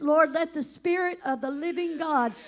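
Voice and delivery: ordinary talk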